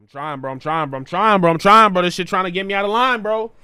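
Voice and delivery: building from calm to loud frustration; calm turning angry. Real, not acted. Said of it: "I'm trying to stay calm".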